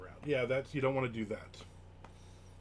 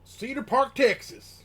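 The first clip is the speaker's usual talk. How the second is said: much higher, louder hammed-up Texan twang; Texan accent; 12 semitones higher, 9 dB louder, slower than usual. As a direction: drawl the place name like a Texan. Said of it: "he doesn't talk like that".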